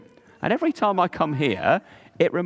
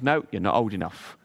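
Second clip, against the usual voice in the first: lower, softer fatherly refusal; stern dad; 8 semitones lower, 4 dB quieter than usual. Acted. The father refusing Carlos, low calm voice. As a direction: turn him down like a firm father.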